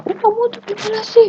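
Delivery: exaggerated indignation; mock angry outburst. Shown as a demonstration. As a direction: snap back in a hammed-up huff. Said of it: "langsung marah-marah".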